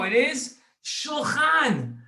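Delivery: "shulchan" said slowly and high, with a guttural ch; announcing the word. Shown as a demonstration. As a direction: unveil "shulchan" slowly, each sound clear. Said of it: "but here we go".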